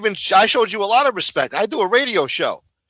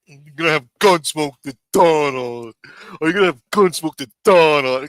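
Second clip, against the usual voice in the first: mock-irritated, pushed louder; imitating mad Dom; 3 dB louder than usual. Mocking how Dom asked about Gunsmoke, faux-mad. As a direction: ape the guest's annoyed question. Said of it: "like, he's mad and shit".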